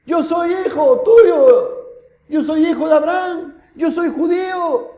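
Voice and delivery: high, stretched-out pleading cry; clamouring. Acted. Rich man pleads to Abraham as his son, crying out. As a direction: cry out your claim of kinship.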